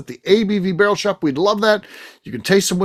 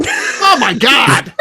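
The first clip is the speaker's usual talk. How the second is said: surprised; 8 semitones higher, 4 dB louder, much slower than usual. Real, not acted. Reacts to Kathy's hiccups.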